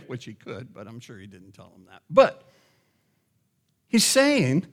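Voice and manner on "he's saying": ordinary talk, nothing marked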